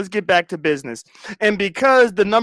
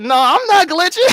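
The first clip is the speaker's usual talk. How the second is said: mock high shout; 11 semitones higher, 6 dB louder than usual. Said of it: "She glitching".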